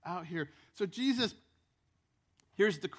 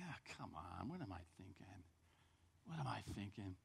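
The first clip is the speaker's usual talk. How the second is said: much softer, lower; doubtful murmur; 7 semitones lower, 14 dB quieter than usual. Retold or imitated. Man at the mirror doubting himself, soft and low. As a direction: talk yourself down quietly.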